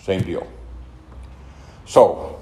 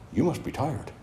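soft, gentle voice of a mild, meek lady; quiet gentle sponsor; 4 semitones higher, 11 dB quieter than usual. Retold or imitated. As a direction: say it softly, like the meek sponsor who is not shocked. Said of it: "but a very quiet lady".

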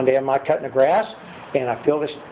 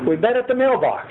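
usual speaking voice

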